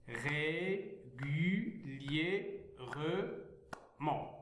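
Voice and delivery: very slow régulièrement, every syllable apart; régulièrement in long, evenly spaced syllables. Shown as a demonstration. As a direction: beat out régulièrement as ré, gu, liè, re, ment. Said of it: "lire les mots syllabiquement".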